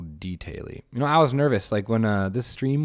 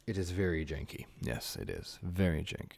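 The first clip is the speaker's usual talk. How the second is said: normal talking voice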